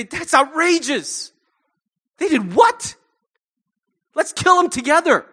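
a mock righteous outburst, half joking; mock outrage. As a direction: play the indignant friend whipping up a fight. Tempted to take sides in rage.

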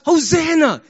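loud, high cheer; crowd shout. Acted. Football crowd at the crucial play, shouting. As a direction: shout it like a whole stadium. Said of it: "And the whole crowd shouts".

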